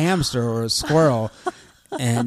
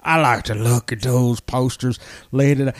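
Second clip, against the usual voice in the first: Sling Blade voice; 3 semitones lower than usual. Acted. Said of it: "kind of a character like this, uh-huh, kinda like a Sling Blade motivated character".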